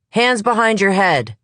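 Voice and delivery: loud, high command; officer's shout. Acted. Front officer shouting at the suspect, loud and high. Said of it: "the front officer shouts".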